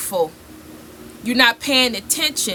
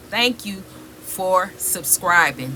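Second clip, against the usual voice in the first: usual speaking voice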